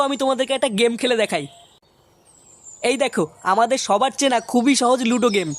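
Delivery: upbeat sponsor read. Acted. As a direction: sell the game app like an ad. Game app ad read.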